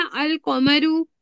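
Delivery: slow, clear "अल कमरू" with the L sounded; drawn-out "अल कमरू" saying the L clearly; lam pronounced. Shown as a demonstration. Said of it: "हुर्फ कमरी जब होता है तो लाम को पढ़ते हैं".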